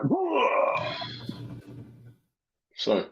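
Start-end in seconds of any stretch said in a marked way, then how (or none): 0.0-0.9 high, wailing mock whoa; high drawn-out cry of mock awe; imitated crowd whoa. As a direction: imitate a crowd oohing at fireworks. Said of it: "which are like".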